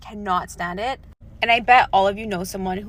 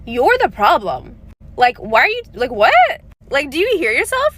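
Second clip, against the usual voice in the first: incredulous, louder and higher, at a loud clip; exasperated disbelief; 5 semitones higher, 5 dB louder than usual. Retold or imitated. Exasperated friend snapping back at someone playing victim. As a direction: scold them for not seeing it.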